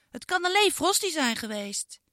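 put-on a girl stubbornly blaming the dog; girl Iris insisting.

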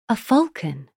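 awed thought. Acted. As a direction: think it in wonder. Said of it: "Sigurd thought".

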